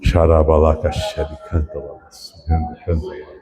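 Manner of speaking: low, soft glossolalia; speaking in tongues. Real, not acted. Pastor overcome after speaking of God's embrace, speaking in tongues. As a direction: murmur prayer syllables under the breath.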